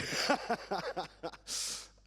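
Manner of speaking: a short airy laugh, high up; breathy laugh. Real, not acted. Panel speaker laughing at his own Hagrid joke, airy laugh. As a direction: let out a quick unvoiced laugh.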